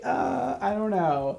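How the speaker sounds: very high, squeaky mock hesitation; scared stammer. Stylist scared of clients, mock-timid stammer. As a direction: imitate a timid, halting squeak. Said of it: "It made me definitely scared of clients".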